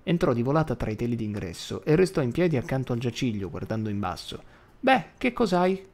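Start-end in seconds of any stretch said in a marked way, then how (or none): 4.8-5.8 put-on a touch of a high, slow old woman; lightly voiced granny, probing; mildly voiced old woman's question; grandma asking. Grandma questions sick husband.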